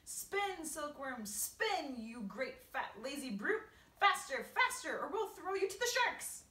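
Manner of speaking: urgent high shouted command; shouting. A narrator's reading. Centipede exhorting silkworm to greater efforts, shouting. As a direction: drive them on with loud, high yells.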